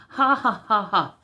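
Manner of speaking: high, loud fake chuckle to flatter; nervous put-on laughter; laughing along to dodge trouble; forced laugh. Salesman fakes a nervous laugh.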